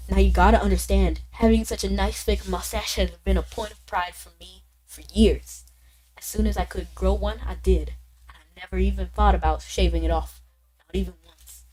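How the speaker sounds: put-on Southern accent; storytelling with a Southern twang; Walter. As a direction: tell it earnestly in a Southern accent. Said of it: "giving him a southern accent".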